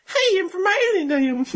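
very high squeaky put-on voice; mock foreigner impression. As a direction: imitate him in a silly, sky-high voice. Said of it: "Here's a video of him talking".

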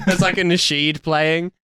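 ordinary talk, nothing marked

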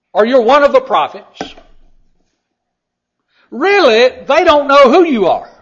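loud, high, ringing pulpit delivery; full-force preaching. Acted. Preacher proclaims the disciples' answers at full force. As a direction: preach it out over the congregation.

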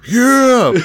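high, loud whooping yell; triumphant cheer. Retold or imitated. Kid celebrating that the rain stopped, triumphant cheer. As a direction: cheer it out like a kid who won.

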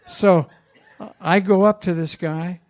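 normal talking voice

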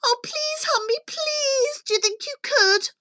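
high, hurried piggy plea; desperate little pig on the phone; pleading pig. Acted. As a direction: beg for help in a high, urgent voice. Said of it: "The pig cried".

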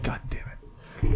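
whispered curse under the breath; muttered curse. Retold or imitated. Annoyed at the group chat chime, whispered curse.

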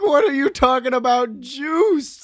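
mock freak-out at the word juice. Girlfriend freaking out at him, loud incredulous yelp. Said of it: "she just starts fucking freaking out".

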